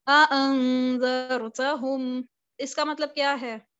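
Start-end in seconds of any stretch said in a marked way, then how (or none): 0.1-2.2 अअंज़रतहुम recited slowly, each hamza distinct; slow drawn-out अअंज़रतहुम, no merging; with tahqiq. Shown as a demonstration. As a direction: pronounce अअंज़रतहुम with full tahqiq, hamzas clear. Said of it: "तहकीक के साथ पढ़ा".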